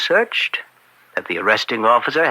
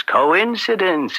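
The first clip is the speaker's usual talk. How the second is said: higher and slow, feigning innocence; a sing-song shrug of a word; mock-innocent lilt; 8 semitones higher, slower than usual. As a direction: lift it up as if it's all chance. Slyly brushing off the bribe link.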